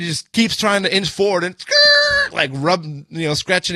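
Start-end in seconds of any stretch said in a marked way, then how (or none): 1.6-2.3 high, loud imitated metal screech; imitated bumper scrape, squealed high; screeching scrape noise. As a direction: make the drawn-out skrrt of a truck scraping.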